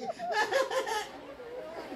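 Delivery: squealing laughter; high laugh. Real, not acted. Laughing at own joke. (tawa tinggi)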